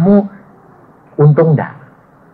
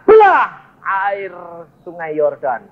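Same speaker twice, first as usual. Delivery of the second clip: a slow, lightly voiced divine command; God commanding Moses, slow and weighty; 2 semitones higher, slower than usual. God tells Moses to split the Jordan, slow solemn command. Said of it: "lalu Tuhan berkata kepada Musa".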